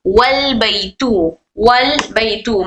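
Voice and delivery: slowly, joining वल straight into बैतू; slow demo. Shown as a demonstration. Teacher showing students the joined reading, slow and careful. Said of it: "वाव को लाम से मिलाकर पढ़ेंगे".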